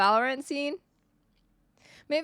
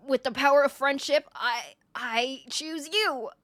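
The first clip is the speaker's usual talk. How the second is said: Deku voice. Pretend. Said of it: "that's my Deku voice".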